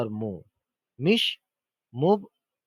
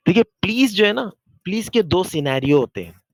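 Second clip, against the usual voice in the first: calling for attention; 3 semitones higher, 8 dB louder than usual. Real, not acted. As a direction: raise the voice to grab attention. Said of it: "यहाँ ज़रा तवज्जो चाहूँगा मैं आपकी".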